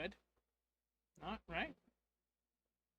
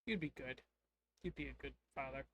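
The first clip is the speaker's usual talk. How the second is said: somber; 3 semitones lower, 4 dB quieter than usual. Acted. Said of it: "somberly looks over at me and goes".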